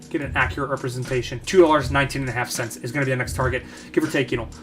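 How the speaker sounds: ordinary talk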